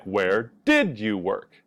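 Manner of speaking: "Where did you work?" said slowly with "did" clear; slow demo question. Shown as a demonstration. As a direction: model the past question slowly. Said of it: "so we have something like".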